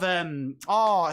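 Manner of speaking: ordinary talk, nothing marked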